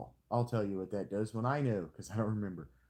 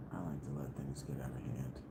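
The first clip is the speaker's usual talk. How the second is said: conspiratorial whisper; 10 semitones lower, 8 dB quieter than usual. Acted. Confessing a sneaky strategy.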